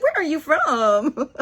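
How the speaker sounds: high, loud, drawn-out question through laughter; giggly, smitten curiosity, voice lifted; delighted gushing. Retold or imitated. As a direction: gush with amused fascination, stretching it out. Gushing quote to Scottish stranger.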